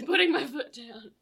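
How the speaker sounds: loud, laughing put-down; mock firm declaration. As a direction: declare it like a stern boss.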